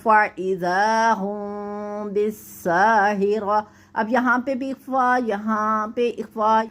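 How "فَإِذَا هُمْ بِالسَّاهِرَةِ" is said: slow, drawn-out Quranic recitation. Shown as a demonstration. Teacher recites the verse slowly for the student. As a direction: chant each syllable long and measured.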